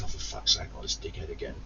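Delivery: hushed fed-up grumble under the breath; muttered inner groan. Retold or imitated. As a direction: mutter it barely voiced.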